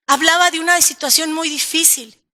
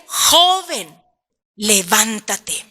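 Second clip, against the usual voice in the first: a firm call on joven, then a lower, slow levántate; commanding Jesus; 6 semitones lower, much slower than usual. Acted. Preacher voicing Jesus ordering the young man to rise, lightly firm. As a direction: give the dead youth a calm command to rise. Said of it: "Directo le dice a él".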